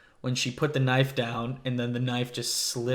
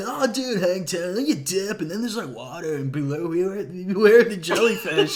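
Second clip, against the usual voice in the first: surfer dude voice; 6 semitones higher, 4 dB louder than usual. Retold or imitated. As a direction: play a chill surfer guy. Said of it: "you sound like a surfer".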